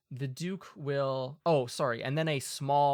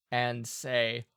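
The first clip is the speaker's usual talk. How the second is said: ordinary talk, nothing marked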